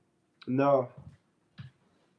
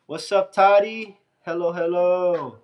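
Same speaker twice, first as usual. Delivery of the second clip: louder, slower cheerful hello; excited greeting; 4 semitones higher, 6 dB louder than usual. Real, not acted. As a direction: greet them with a big welcome. Greeting viewer joining stream.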